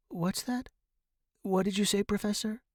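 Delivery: high, worried questioning; anxious concern. A narrator's reading. Student straining to hear professor, anxious.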